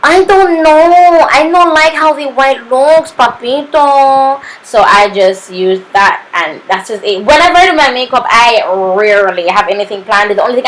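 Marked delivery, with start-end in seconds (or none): 0.0-4.4 high, drawn-out mock complaint; mock fussing. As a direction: draw it out high, playfully put-on. Acting out own fussy reaction.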